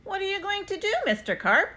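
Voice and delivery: put-on boy asking. A narrator's reading. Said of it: "I asked him".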